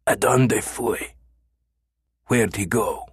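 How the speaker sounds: slow, strained, misshapen voice; strange choked voice. A narrator's reading. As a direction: choke the words out slowly. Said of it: "A strange voice choked".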